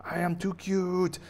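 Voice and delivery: high-pitched defiant bunny boast; invincible baby bunny. Acted. Bunny shrugging off ray guns, high gloat. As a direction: boast up high, stretching the ending. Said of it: "And like".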